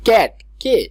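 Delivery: "ক্যাট", then its opening sound "কে" alone, loud and high; sounded-out first letter. Shown as a demonstration. Teacher showing how C sounds in cat, sounded out. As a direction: punch out "ক্যাট", then isolate "কে". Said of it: "সি এর উচ্চারণ সি".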